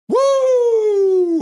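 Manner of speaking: loud high sustained "woo"; whooping cheer. Retold or imitated. Host voicing a chat fan's celebration, whooping loudly. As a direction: let out a long, high fan whoop.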